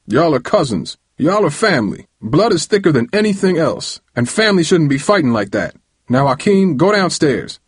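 stern uncle laying down the law; angry scolding. A narrator's reading. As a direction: scold two boys like a fed-up uncle. Angry uncle scolding the fighting cousins.

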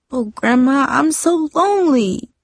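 high, pleading cry of loneliness; a lonely girl crying to her grandma. A narrator's reading. Lonely girl cries out to her grandma, pleading. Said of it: "Darcy cried".

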